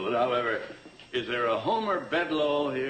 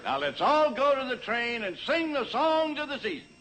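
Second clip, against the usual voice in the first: rally the crowd like Santa; calling out; 10 semitones higher than usual. Acted. Calling everyone to the train.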